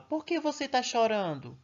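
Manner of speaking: put-on teacher demonstrating a question to children, questioning tone. Shown as a demonstration. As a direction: ask it clearly as a question, pitch up. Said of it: "Olha a forma que eu te falo".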